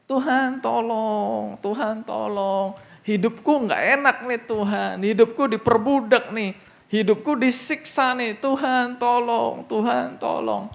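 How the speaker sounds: high, slow desperate plea to God; pleading cry for help. Acted. Preacher voicing Israelites crying out to God, high slow plea. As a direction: beg God slowly as an oppressed slave. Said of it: "Orang Israel berseru-seru minta tolong kan".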